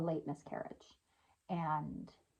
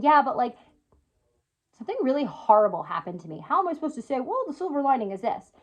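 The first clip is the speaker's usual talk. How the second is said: higher, louder skeptical pushback; doubtful viewer; 5 semitones higher, 5 dB louder than usual. Pretend. Voicing a viewer who doubts her advice, skeptical and incredulous. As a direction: push back as a doubting listener would.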